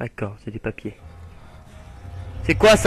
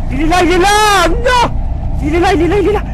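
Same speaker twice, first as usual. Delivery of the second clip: high, loud cries of alarm; frantic alarm, voice leaping up; panicked shouting; 10 semitones higher, 4 dB louder than usual. Real, not acted. Panics as monster appears in game.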